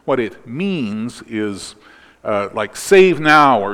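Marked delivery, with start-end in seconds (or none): 2.8-3.6 loud, higher-pitched cry for rescue; raised-voice acted outcry; exclamation. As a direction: exclaim it loudly, like a plea. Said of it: "kind of an exclamation".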